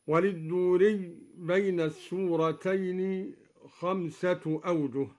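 ordinary talk

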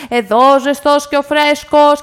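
loud, drawn-out street-seller's cry; vendor's shout. A narrator's reading. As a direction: call out your wares slowly across the hall. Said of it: "φώναξε ο Πασατεμπάς". (δυνατή κραυγή πλανόδιου πωλητή)

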